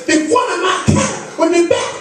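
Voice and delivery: put-on high, loud, frantic Haitian accent; over-the-top Haitian accent, wild and loud; crazy Haitian lady. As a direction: shriek it as an irate Haitian driver, words stretched out. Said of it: "some crazy Haitian lady".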